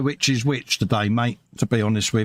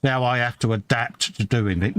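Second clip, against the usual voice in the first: ordinary talk, nothing marked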